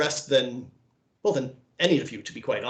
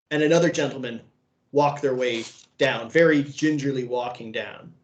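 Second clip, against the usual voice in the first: usual speaking voice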